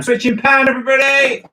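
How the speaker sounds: cheerful group callout; calling out. Real, not acted. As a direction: announce it to the whole class. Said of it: "I'm gonna switch in pan".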